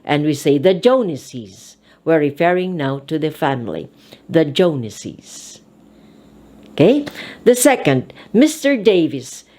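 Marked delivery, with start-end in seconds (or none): 0.6-1.8 "the Joneses" with an added -iz syllable; plural ending. Shown as a demonstration. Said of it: "we have to add E S". 4.3-5.6 "the Joneses" with an added -iz syllable; "Joneses" said JONE-iz-iz, ending drawn out; plural ending. Shown as a demonstration. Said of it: "we have to add E S".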